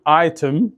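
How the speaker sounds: "ايتم" with a firm, released T; stressed British T in "ايتم"; British crisp T. Shown as a demonstration. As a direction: say "ايتم" as British "eye-tem", T fully sounded. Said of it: "البريتش يقول التي يركز عليها".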